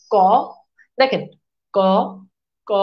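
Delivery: ক said with so little breath that paper held before the mouth stays still; unaspirated k. Shown as a demonstration. Teacher testing ক against a paper note. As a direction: keep ক tight, no air escaping. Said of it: "মুখ দিয়ে বাতাস খুব কম বের হচ্ছে".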